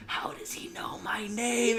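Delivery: slow, breathy whisper; hushed mock alarm; spooked whisper. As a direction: imitate someone creeped out, whispering in disbelief. Spooked gamer reacting to troll.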